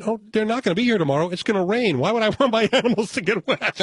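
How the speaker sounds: high, fast, innocent excuse; mock innocent woman. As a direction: play her breezy and innocent, quickly.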